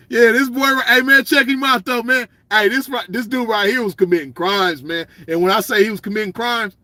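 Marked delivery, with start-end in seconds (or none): none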